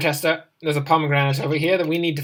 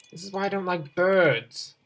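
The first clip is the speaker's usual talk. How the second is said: ordinary delivery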